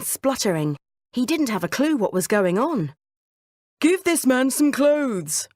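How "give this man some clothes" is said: higher, slow, commanding order; royal order. Acted. King ordering servants to clothe Jasper, commanding. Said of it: "ordered the king".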